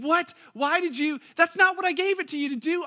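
loud, high acted indignation; furious. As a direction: demand an answer in mock fury. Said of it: "You'd be, like, furious and saying".

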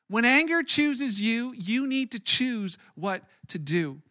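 slowed right down, each word weighed; slow deliberate. Shown as a demonstration. Pastor slowing to stress a key line, slow and deliberate. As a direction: let the line sink in slowly. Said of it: "I would say it this way".

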